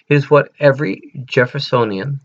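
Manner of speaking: normal talking voice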